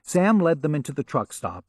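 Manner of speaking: usual speaking voice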